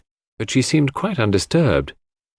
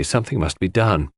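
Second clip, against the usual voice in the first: ordinary delivery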